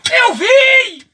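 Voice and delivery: loud, high shout; frightened insistence. Acted. Frightened digger insists to doubting mates, shouting high. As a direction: shout it out in alarm.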